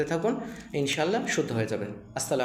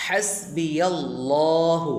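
হাসবিয়াল্লাহু slowly, the "llaa" drawn out; one-count stretch; 2 semitones higher, 4 dB louder, much slower than usual. Shown as a demonstration. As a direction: chant হাসবিয়াল্লাহু, lengthening "llaa". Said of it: "এক আলিফ".

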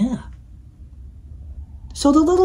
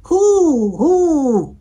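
high, drawn-out owl hoots; loud, swooping storybook hoot; 7 semitones higher, 6 dB louder than usual. A narrator's reading. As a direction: hoot like a big round owl. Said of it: "hooted the owl".

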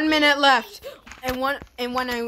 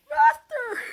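excited cry; 13 semitones higher, 3 dB louder than usual. Real, not acted. Cheering the cart.

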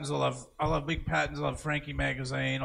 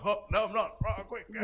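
mock panic; 7 semitones higher than usual. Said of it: "I might be like".

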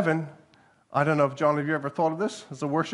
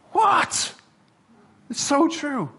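high, loud astonished cry; high amazed exclamation; 12 semitones higher, 4 dB louder, much slower than usual. Real, not acted. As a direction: burst out in wonder. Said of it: "amazed at the scripture".

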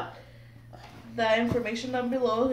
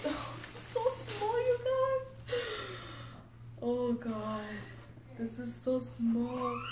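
awed cooing; 2 semitones higher, 7 dB quieter, slower than usual. Real, not acted. Seeing the baby doll.

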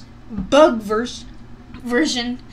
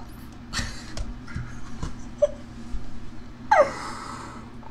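shrieking laugh; 13 semitones higher, 8 dB quieter than usual. Real, not acted. Reacting to gross video.

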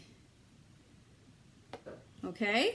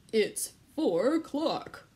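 wolf voice; slower than usual. Acted. Wolf answers the children.

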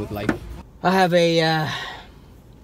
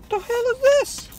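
surprised. Real, not acted. Puzzled by an item.

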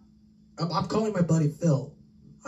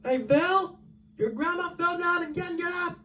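frantic high call. Acted. Calling out grandma has fallen.

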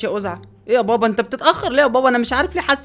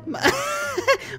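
very high squealing laugh; high giggle; 14 semitones higher, 5 dB quieter than usual. Acted. Son squeals with laughter at the name, high giggle.

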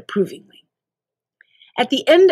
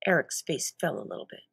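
ordinary delivery

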